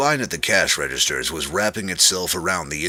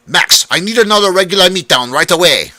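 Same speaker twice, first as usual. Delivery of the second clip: loud call; 13 semitones higher, 13 dB louder than usual. A narrator's reading. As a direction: bark the order across the kitchen. Manager calling orders.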